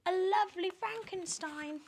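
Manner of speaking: very high, sneering witchy voice; high mocking hag voice; spiteful Aunt Spiker. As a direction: jab at her sister with a high sneer.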